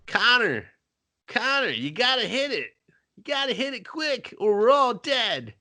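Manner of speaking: high pleading urgency; urgent high voice. Retold or imitated. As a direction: urge him fast, panicked. Team urging Connor.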